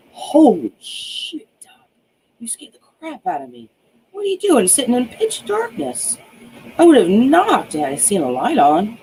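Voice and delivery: guard caught off guard, mild surprise; lightly voiced startled guard. A narrator's reading. Night guard startled by doctor in dark, mild surprise. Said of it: "startled her".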